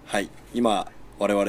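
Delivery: ordinary talk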